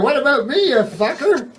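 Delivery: half-laughing, high-pitched mock complaint at the ghost; indignant retort. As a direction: throw it back at the moaning ghost. Snaps back at the ghost.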